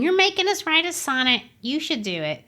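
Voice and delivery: imitating sulky kids protesting; teacher mimicking students griping about the sonnet; mock grumbling students. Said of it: "they were grumbling and saying".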